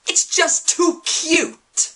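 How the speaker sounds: high, drawn-out fan squeal; slow high-pitched cooing delight; gushing. Real, not acted. Gushing over a favourite pairing.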